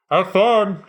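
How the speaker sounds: loud cheery shout through a gas mask; muffled yell. Acted. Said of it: "In a muffled voice, he yells out to you".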